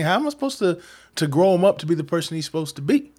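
a father begging to know how to raise his son, anxious and rushed; anxious plea. Retold or imitated. Worried father begging the visitor, high and fast.

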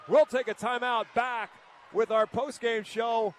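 loud, excited game-end call; play-by-play call. Acted. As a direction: shout it like the final buzzer.

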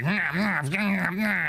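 high, repeated cries of being bitten; high mock yelps under attack; pained cries. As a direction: yelp along as the worms bite. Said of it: "and you're like".

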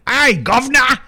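loud, high hammed-up Cockney shout; hard Cockney. Shown as a demonstration. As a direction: holler it like a cartoon Cockney. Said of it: "deep, hard Cockney".

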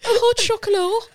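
put-on English words in a high French accent; hammed-up French accent, loud and high; mock French tone. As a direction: order it with a silly French lilt. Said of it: "a French tone".

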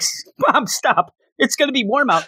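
put-on high, whiny teenage protest; annoyed teen. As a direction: brush off mom like an embarrassed teen. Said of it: "He's like".

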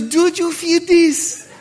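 put-on slow questioning French accent; French nurse accent. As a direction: ask slowly like a French nurse. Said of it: "She comes back with a French nurse".

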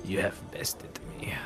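hammed-up French gambler losing, with a whispered concession; theatrical dismay at a losing hand, lightly accented; Bretonnian accent. Gambler loses the hand and concedes, mock-dramatic accent.

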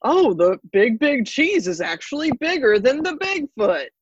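booming ad announcer; monster truck ad. Retold or imitated. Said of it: "the announcer's like".

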